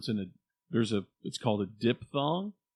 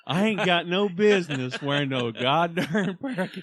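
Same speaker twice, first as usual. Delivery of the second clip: higher and louder, laughing through it; laughing rant; 6 semitones higher, 5 dB louder than usual. Real, not acted. Host laughs while mocking himself over cowboy boots. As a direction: laugh your way through the complaint. Joking self-mockery with laughter.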